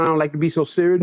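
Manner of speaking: ordinary talk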